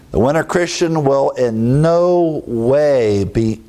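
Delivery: "no way" slowly drawn out; emphatic. Shown as a demonstration. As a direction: stretch "no way" word by word for emphasis. Stresses the same double negative.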